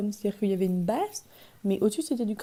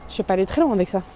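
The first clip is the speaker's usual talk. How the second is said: ordinary talk, nothing marked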